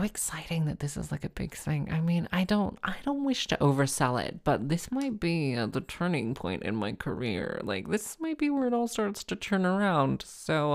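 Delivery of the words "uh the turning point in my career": usual speaking voice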